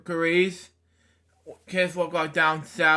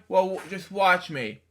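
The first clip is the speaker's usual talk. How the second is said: ordinary delivery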